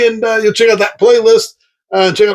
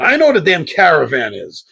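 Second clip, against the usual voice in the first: normal talking voice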